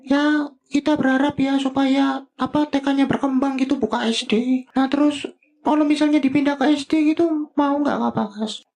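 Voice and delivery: high sidekick voice asking Kak Bagas about teaching; Bobi voice. Acted. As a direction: push the pitch way up like a cartoon sidekick. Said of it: "Bobi tanya lagi deh".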